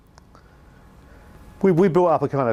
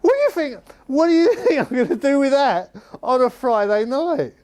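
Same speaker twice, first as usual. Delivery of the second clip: very high strained impersonation breaking into a laugh; high, pinched mock voice with a laugh; John Weller's accent; 12 semitones higher than usual. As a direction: mimic the manager's disbelief. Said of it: "I can't do the accent properly now".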